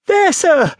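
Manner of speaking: put-on very high child's voice; timid explanation. A narrator's reading. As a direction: explain nervously, pitched right up. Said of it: "I timidly explained".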